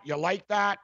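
lightly mocking quarterback catchphrase voice; mocking Kirk Cousins. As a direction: poke fun by aping his gloating catchphrase. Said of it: "Kirk Cousins".

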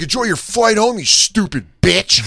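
high, loud mock yell, slowing on "you stupid bitch"; sneer-yell it at the passing woman; imitated yell. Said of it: "He starts yelling at this lady".

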